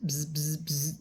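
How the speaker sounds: plain reading voice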